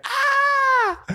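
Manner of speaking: high-pitched drawn-out scream; long, high "aah" of plane panic; mock scream. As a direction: imitate a terrified passenger's scream, very high. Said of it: "screaming at the top of their lungs".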